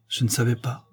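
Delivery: plain reading voice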